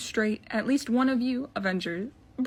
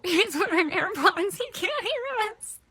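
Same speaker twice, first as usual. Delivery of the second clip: high, strained squeal of alarm; hammed-up dismay, rising to a squeak; mock panic; 9 semitones higher, slower than usual. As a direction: wail it slowly, voice climbing very high. Panicked realisation in a skit.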